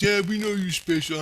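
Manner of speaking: high, mocking put-on voice; sarcastic staff voice. Staff member mocking him as special, high sarcastic voice. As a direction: sneer it back sarcastically. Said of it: "He goes".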